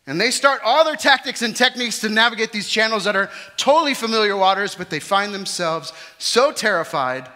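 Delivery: loud, high preaching; preaching at full force. Acted. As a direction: preach it out to the hall.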